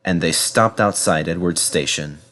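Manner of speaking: usual speaking voice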